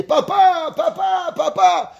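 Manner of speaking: high calling for his father; child calling for his dad. Retold or imitated. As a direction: call papa across the train. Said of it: "je commence à crier".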